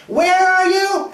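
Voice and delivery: very high, drawn-out holler; loud sing-out call. Acted. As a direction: yell it slowly across the house. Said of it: "you call out".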